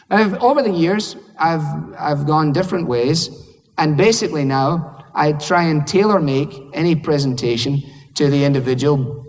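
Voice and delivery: ordinary talk, nothing marked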